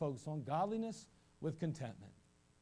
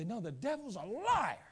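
coaxing louder; 6 semitones higher, 3 dB louder than usual. Retold or imitated. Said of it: "I'd say".

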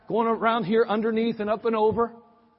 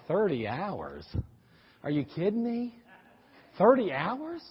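mock disbelief; 3 dB quieter, slower than usual. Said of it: "And you're like".

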